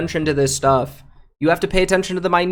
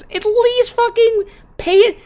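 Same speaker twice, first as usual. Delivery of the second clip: exasperated; 14 semitones higher, 4 dB louder than usual. Real, not acted. Ranting at guild.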